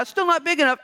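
high, loud mock whine; whiny complaint. Preacher mocks tribes crying the land is too small, whiny. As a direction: whine like a kid with soggy cereal.

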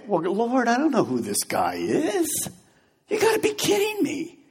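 pleading, then shooting way up on kidding me; incredulous whine at God; doubtful protest. Pretend. Philip balking at the Lord's order, incredulous whine.

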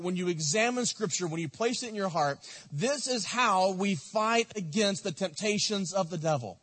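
ordinary delivery

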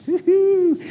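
a sudden gleeful cry; pastor's pretend God whoops before the swing; playful whoop. Playful cheer mid-skit.

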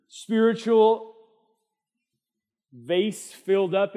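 slow, loud and high on "spiritual vase filled up"; preacher lingering on "spiritual"; drawn-out emphasis. Acted. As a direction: stretch each word for weight. Preacher stretching key phrase.

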